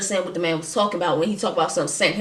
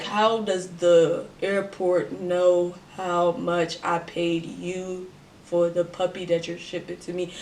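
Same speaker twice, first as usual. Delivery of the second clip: slow, quiet disbelieving question; drawn-out suspicious questioning; skeptical question; 2 semitones lower, 4 dB quieter than usual. Retold or imitated. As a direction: ask it slowly like you smell a rat.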